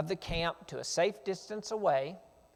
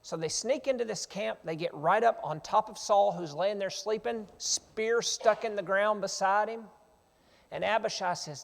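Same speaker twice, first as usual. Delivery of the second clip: ordinary talk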